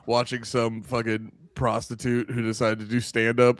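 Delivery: ordinary delivery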